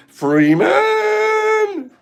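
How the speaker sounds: high, calling out a name in a strange put-on voice; odd yelling voice. Host calls to viewer Freeman, goofy high shout. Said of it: "I don't know why I'm talking like that".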